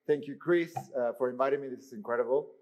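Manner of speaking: normal talking voice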